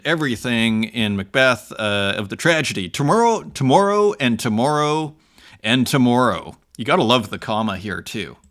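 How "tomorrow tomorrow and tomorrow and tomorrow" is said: dramatic Shakespeare recitation. Acted. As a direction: declaim Macbeth's lament with weight. Reads Macbeth speech aloud.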